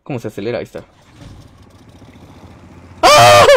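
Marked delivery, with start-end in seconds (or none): 3.0-3.5 sudden high yelp of fright; a real jolt of fear, cried out; startled scream. Real, not acted. Startled yelp at a scare in the game. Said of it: "Me espanté".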